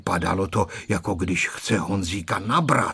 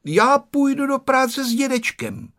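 put-on high-pitched, determined little boy; resolute boy; 14 semitones higher than usual. A narrator's reading. Boy telling the canteen cook he works with grandpa, resolute. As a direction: declare it in a raised, decided voice. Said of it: "řekl rozhodným hlasem Honzík".

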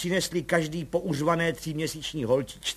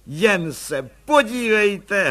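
loud gushing; 6 semitones higher, 6 dB louder, much slower than usual. A narrator's reading. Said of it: "hlaholila ta posluhovačka".

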